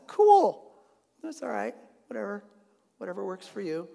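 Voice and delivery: mock nonchalance. Danites brushing off the priest's idols, mock casual. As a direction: shrug it off like it's nothing.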